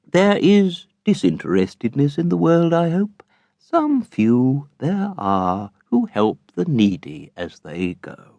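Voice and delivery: lightly voiced smug moralizing father; a hint of a self-satisfied patriarch, unhurried; pompous Pecksniff. A narrator's reading.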